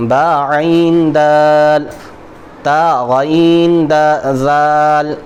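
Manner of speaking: slow, drawn-out Quranic letter drill; slow teaching recitation. Shown as a demonstration. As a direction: hold each letter name for the pupil. Recites letter pairs slowly.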